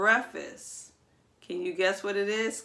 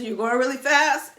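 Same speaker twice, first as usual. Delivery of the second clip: lively speeding-up; 3 semitones higher, 5 dB louder than usual. Shown as a demonstration. Acts out driving fast before slowing.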